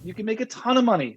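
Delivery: high, insistent excitement; fired up. Real, not acted. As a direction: push the point with real fervour. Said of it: "I get fired up".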